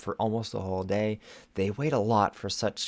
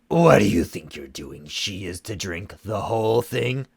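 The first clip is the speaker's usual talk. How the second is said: loud, cutting reprimand; sharp scolding; 8 dB louder than usual. Acted. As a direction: snap at her to obey. Shadow Weaver chastising.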